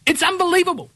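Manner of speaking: exasperated, high-pitched, each word spaced out; ranting, voice pitched right up; worked-up rant. Real, not acted. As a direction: hammer every word in high, slow exasperation. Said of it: "I'm not gonna be calm".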